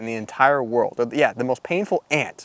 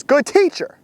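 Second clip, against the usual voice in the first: fawning flatterer; 5 dB louder, slower than usual. Retold or imitated. Rich young ruler flatters Jesus.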